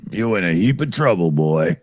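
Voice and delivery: low, quick Southern sheriff drawl. Retold or imitated. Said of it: "What's that from again?".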